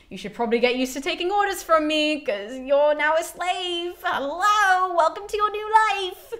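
high, sing-song mock greeting; exasperated. Clytemnestra trying to get through to silent Cassandra, exasperated. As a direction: pitch it up, talking at someone who won't respond. Queen tries rousing unresponsive Cassandra.

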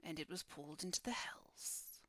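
hushed, half-whispered guardian voice with long pauses; solemn guardian. Acted. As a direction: speak as a solemn keeper of dark stories. Guardian recounts the building's fall.

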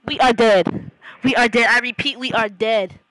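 loud, dramatic spy report; mock despair. Reporting to chat that the spy mission failed, loud mock despair. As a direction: announce defeat like a fallen agent.

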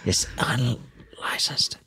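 secretive whisper. Acted. Coach confiding about gun.

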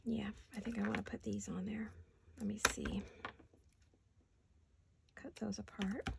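whispering to herself; half-voiced working murmur. Real, not acted. Muttering to herself while cutting.

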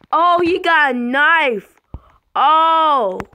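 loud, high startled cry; alarmed discovery. Real, not acted. Spots killer with a knife.

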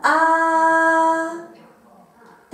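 "あー" pushed from the stomach, lips firm; a long belly-supported "あー", not the Japanese あ; Chinese a from the belly. Shown as a demonstration. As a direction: support "あー" with belly muscles, held steady. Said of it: "このお腹に力を入れてください".

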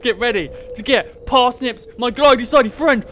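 playful battle cry at a penguin; mock battle cry. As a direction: yell it as you charge. Charging with the club.

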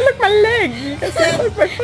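giggling. Real, not acted. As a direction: point it out while cracking up. Laughing while showing leg.

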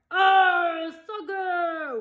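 high crew echo; crew yell. A narrator's reading. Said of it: "the crew yelled".

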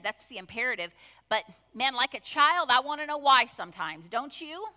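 ordinary delivery

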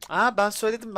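loud gloating I-told-you-so; triumphant shout. Real, not acted. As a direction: crow it out loud. Gloating at streamer teammate.

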